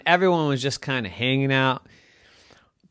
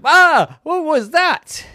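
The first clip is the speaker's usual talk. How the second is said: loud, high cry of alarm; startled fright; 11 dB louder than usual. Acted. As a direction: yelp it like someone running from a ghost. Person runs from ghost's horn.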